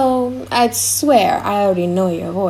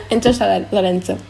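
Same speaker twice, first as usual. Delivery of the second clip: ordinary delivery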